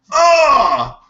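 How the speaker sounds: high, loud mock yelp of pain; hammed-up shout as if just shot; mock pained cry. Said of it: "It's not like he was like".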